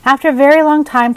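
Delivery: ordinary delivery, nothing marked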